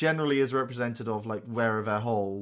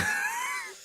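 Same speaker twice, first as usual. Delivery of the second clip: high squeaky laugh. Real, not acted. Laughing at metaphor.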